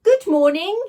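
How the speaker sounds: loud, high booming hello; booming greeting. Acted. Slime blob greeting the boy in a booming voice. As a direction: boom it out grandly. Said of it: "it boomed".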